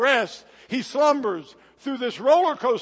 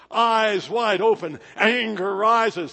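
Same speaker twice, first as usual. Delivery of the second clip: slow emphatic build; 6 dB louder, slower than usual. Acted. Preacher building intensity.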